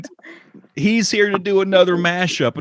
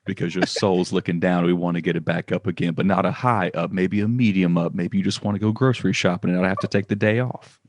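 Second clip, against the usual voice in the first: ad voice; 8 semitones lower than usual. Pretend. Mock ad voiceover, deep.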